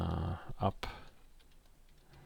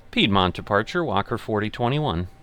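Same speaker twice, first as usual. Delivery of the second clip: pilot radio readback; 2 semitones higher, 6 dB louder than usual. Acted. Pilot check-in with departure.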